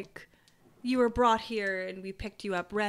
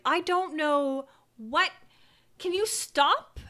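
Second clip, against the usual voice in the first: halting, slow, higher-pitched plea; uncomfortable plea; 5 semitones higher, 4 dB louder, slower than usual. Acted. Priest reaching out to the one cutting, uncomfortable slow plea. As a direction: plead awkwardly, stretching the words out. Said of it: "uncomfortably trying to reach out".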